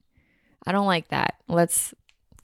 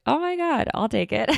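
much higher, gleeful "Oh my God"; delighted squeal; 6 semitones higher than usual. Retold or imitated. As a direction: squeal with mock greed over the gift. Delighted reply to mom.

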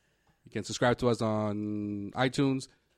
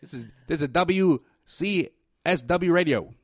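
put-on old-time newsreel announcer; hammed-up radio newsman; 1940s radio reporter; 6 semitones higher, 11 dB louder than usual. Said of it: "You sound like a nineteen forties reporter".

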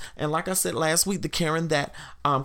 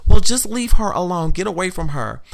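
"Well, just leave her alone" called out high and loud; mocking shout; 4 semitones higher, 10 dB louder than usual. Fellow Karen shouting at the woman to leave her be. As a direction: snap it like a meddling bystander.

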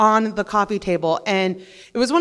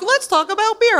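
mocking voice; 12 semitones higher, 4 dB louder than usual. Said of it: "And they're like".

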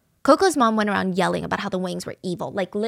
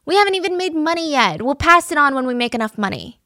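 high taunting shout out the window; mock yell from window; 5 semitones higher, 5 dB louder than usual. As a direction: jeer back at the angry neighbor. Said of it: "They would scream out the window".

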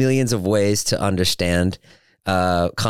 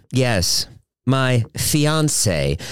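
normal talking voice

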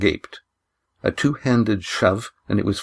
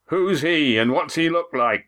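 high-pitched, demanding innkeeper; pressing challenge, voice pitched up; 9 semitones higher than usual. A narrator's reading. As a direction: question the stranger as a demand. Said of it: "Walt demanded".

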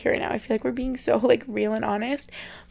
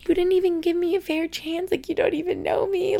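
imagined reply to critics; 7 semitones higher than usual. Retold or imitated. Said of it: "I just wanna be like".

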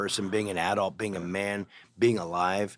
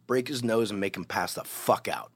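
normal talking voice

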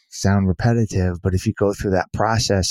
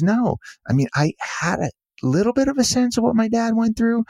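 pitched well higher, earnest daughter; daughter's voice; 8 semitones higher than usual. Retold or imitated. Daughter confiding in the author, high and earnest. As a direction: play the daughter, guilt spilling out. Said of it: "And she goes".

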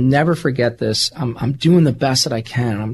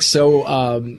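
ordinary delivery

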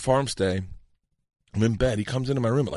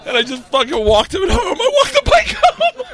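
ordinary talk

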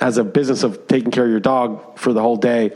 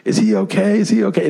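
imitating a panicked kid, higher pitch; a mock-anxious repeat, pitched higher; freaked out; 9 semitones higher than usual. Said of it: "she's, like, freaked out".

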